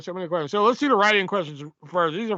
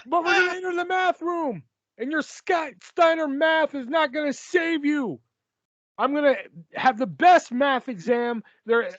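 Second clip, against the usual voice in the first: Matt Hardy; 7 semitones higher than usual. Acted. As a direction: shout it high with a put-on accent. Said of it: "I don't know why".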